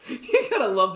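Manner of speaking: laughing through the words. Real, not acted. Cracking up at the old man in the clip, laughing.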